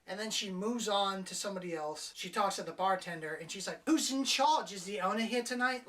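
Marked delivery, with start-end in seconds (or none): 3.9-5.9 louder, higher demanding tone; a hint of entitled swagger; cocky. Woman grilling the bartender, mock cocky. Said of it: "this cocky fucking attitude".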